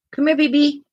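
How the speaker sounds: high coaxing pet voice; talking to a pet. Real, not acted. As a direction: coax the puppy sweetly, high. Coaxing the dog.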